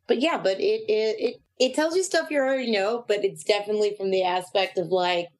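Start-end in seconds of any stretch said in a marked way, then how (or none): none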